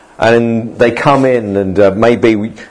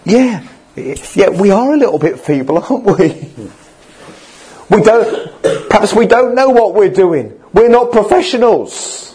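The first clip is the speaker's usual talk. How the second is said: higher-pitched, hesitant admission; discouraged self-doubt; 7 semitones higher than usual. Acted. Ridiculed wall builders doubting themselves, higher-pitched and unsure. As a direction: act out someone conceding they are out of their depth. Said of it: "they might be thinking".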